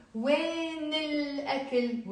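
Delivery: slow, drawn-out "When" joined to الأكل; liaison. Shown as a demonstration. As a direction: stretch "When" into a long L in الأكل. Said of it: "link it with a liaison".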